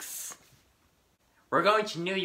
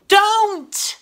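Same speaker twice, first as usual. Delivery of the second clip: sudden shout; 13 semitones higher, 11 dB louder than usual. Acted. Sudden cry cutting someone off.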